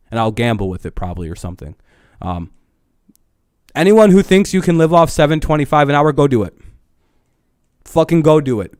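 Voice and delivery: ordinary talk, nothing marked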